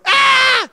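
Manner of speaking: loud, very high mock scream; baby's alarm scream. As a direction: imitate a baby screaming for rescue. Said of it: "I think Shalom's about to kill him".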